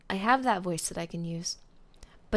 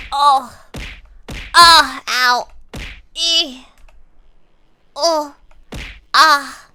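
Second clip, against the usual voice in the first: sharp loud higher cries of pain; pained yelps; 6 semitones higher, 12 dB louder, much slower than usual. Real, not acted. Streamer yelping in sudden pain. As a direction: yelp as if hurt.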